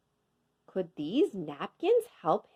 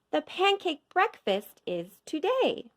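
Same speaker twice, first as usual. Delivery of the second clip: higher, eager announcement; man's cheerful news; 8 semitones higher than usual. A narrator's reading. Man tells George the pancake day, higher. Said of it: "he said".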